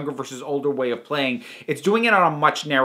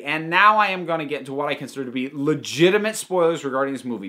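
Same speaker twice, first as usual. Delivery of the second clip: ordinary talk, nothing marked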